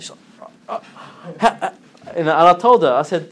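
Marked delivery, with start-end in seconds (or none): 0.7-1.7 imitating someone lost for words; broken, mock-disbelieving splutter; dumbfounded stammer. Woman hearing a claim she can't believe, mimicked dumbfounded stammer. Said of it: "she couldn't believe I'm saying that".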